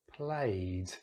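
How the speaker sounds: "played" drawn out to a clear d; D ending. Shown as a demonstration. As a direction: linger on "played" and voice the final d. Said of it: "because it has the D sound".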